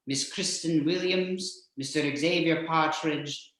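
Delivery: ordinary talk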